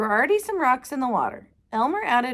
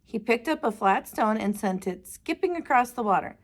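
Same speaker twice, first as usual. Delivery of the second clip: plain reading voice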